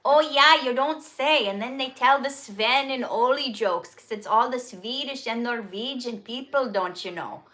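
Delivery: hammed-up Minnesota accent with bouncing pitch; playful, overdone Minnesota lilt; exaggerated Minnesota accent. Shown as a demonstration. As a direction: lay on a thick Minnesota accent. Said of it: "I'm just gonna exaggerate it a little bit here".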